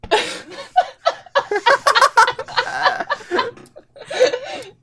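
shrieking, helpless laughter; long high laughing fit. Real, not acted. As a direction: laugh until breathless.

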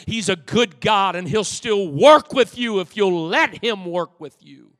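loud, high, driving sermon climax; full-force preaching. Acted. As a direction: preach it out, building to a shout.

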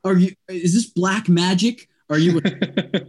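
mock-amazed "what, how, how" at a guide's uncanny call; stunned disbelief. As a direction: play it like you just saw actual magic. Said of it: "And I'm like".

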